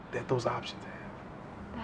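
slow confiding whisper. Acted. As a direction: breathe it like a secret.

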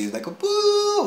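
very high squeaky boo mimicking a ringing frequency; imitated high-pitched tone. Telling friends about the ghostly ringing, high squeaky boo. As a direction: squeeze out a thin high boo like a ringing in the ear. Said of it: "this high-pitched frequency".

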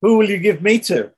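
sarcastic son challenging his father. Retold or imitated. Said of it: "he said sarcastically to his father".

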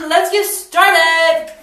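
slow, loud drawn-out announcement; belted catchphrase. Pretend. Vlogger kicks off the piercing with a loud hype call. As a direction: belt it like a show host. Repeated catchphrase, much louder.

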